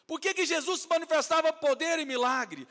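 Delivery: high, loud rhetorical question; full-force preaching. Real, not acted. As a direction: fire the question at the congregation. Preaching climax. (pergunta bradada de pregador)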